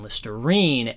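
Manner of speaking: Liste-RINE, last syllable lifted; stress on third beat. Shown as a demonstration. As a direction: lean on the RINE ending of "Listerine". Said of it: "accents the third".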